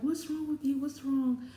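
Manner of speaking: high-pitched mock concern; alarmed sister. Sister answering a FaceTime call, alarmed.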